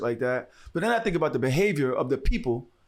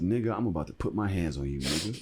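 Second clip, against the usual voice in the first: low threat; 6 semitones lower, 4 dB quieter than usual. Retold or imitated. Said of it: "I said".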